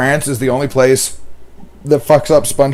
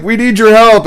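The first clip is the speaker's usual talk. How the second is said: America pleading, pitched up and louder; 7 semitones higher, 5 dB louder than usual. Pretend. America asking the French for help, mock plea. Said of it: "America's going to the French".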